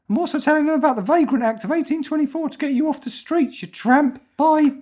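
a wounded figure shouting back; indignant character. Pretend. Character threatens Rob.

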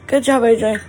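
ordinary delivery

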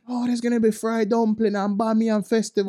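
high mocking quote. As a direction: mimic excited gossipers.

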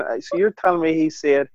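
usual speaking voice

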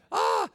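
put-on high delighted squeal of a tossed child; child's squeal. Grandfather imitating grandson tossed in the air, high squeal.

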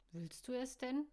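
ordinary delivery, nothing marked

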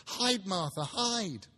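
little ones shouting with glee; children crying out. Acted. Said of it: "cried the two young Cratchits".

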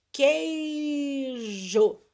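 "queijo" stretched as KEEEI-jo, no U sound; silent U. Shown as a demonstration. Teacher proving the U is silent, drawn-out demonstration. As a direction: hold the first syllable of "queijo" long. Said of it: "a gente não pronuncia".

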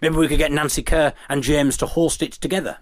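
ordinary talk

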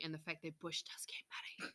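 hushed, sarcastic aside; a sly stage whisper; mock whisper. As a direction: whisper it like an obvious secret.

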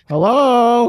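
loud, high drawn-out hello; calling out. Pretend. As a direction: call out in a put-on role. Calling out as the recession.

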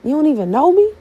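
high, startled cry of disbelief; be stunned that he denies knowing you; shocked disbelief. Pretend. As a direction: pretend shock at being turned away.

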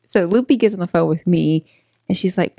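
normal talking voice

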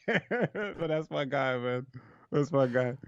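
through laughter, drawn out at first; real amused laughter in the voice; laughing fondly. Real, not acted. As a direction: laugh while you say it. Amused by fish market friend.

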